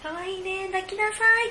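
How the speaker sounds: high-pitched cooing, acted out; playful, high doting voice; doting onlooker. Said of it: "っていう目".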